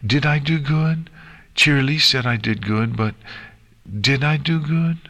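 high-pitched, tentative character voice; nervous. A narrator's reading. Nix asks Twilight about her grades, nervous. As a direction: ask hesitantly, voice lifted. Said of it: "Nix asked nervously".

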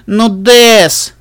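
loud, high whining plea; pleading. A narrator's reading. Little sister begs her refusing brother, whining. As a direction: whine his name insistently.

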